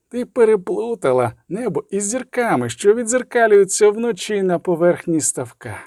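high, cold taunt; mocking inhuman voice. A narrator's reading. Inhuman voice mocking the sorceress's confusion, coldly taunting. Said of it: "насміхався металевий нелюдський голос".

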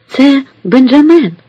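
high, loud, drawn-out cry of recognition; exclaiming. A narrator's reading. Nun recognises the young cabin boy, exclaiming. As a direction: call it out, stretched and high. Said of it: "вигукує черниця".

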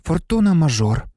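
plain reading voice

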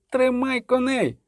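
high, drawn-out urgent command; stretched-out calling cry; yelling order. A narrator's reading. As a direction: yell it slowly, pitched high. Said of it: "дерлася Мільва".

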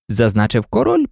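ordinary delivery, nothing marked

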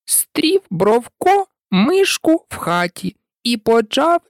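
high, measured verse recitation in a mouse's voice; mouse reciting verse. Acted. Mouse tells Alice its rhymed tale, high recitation.

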